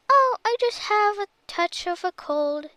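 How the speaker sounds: high squeaky put-on voice. A narrator's reading. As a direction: squeak it out like a sick granny. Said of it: "squeaked the wolf".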